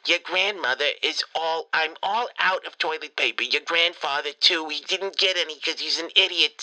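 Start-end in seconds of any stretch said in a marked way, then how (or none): none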